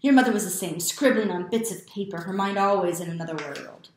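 loud, scornful scolding; father's loud reproach. A narrator's reading. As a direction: loudly condemn the boy's mother and her dreaming. Father's angry rant continues.

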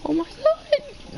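squeaky high cracked oh my god, dragged out slowly; the voice breaks way up on every word; puberty crack that jumps into a squeak. Real, not acted. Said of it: "I heard you get a voice crack".

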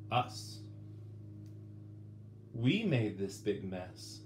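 ordinary delivery

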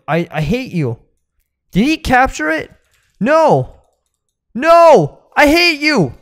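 loud high frustrated protest; real furious gamer yell; outraged yelling. Real, not acted. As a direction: shout it at the thief. Ghost stolen, loud outrage.

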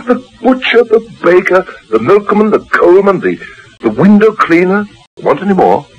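high-pitched, exasperated reeling-off; teasing list. Acted. Husband countering wife over the Cockney accent, mildly exasperated listing.

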